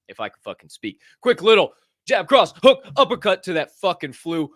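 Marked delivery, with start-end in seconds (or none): 1.2-4.5 loud, high hammed-up boxing calls; pumped-up reenactment of punching the flu; mock fight commentary. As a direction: throw the punches with your voice. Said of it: "a rough reenactment".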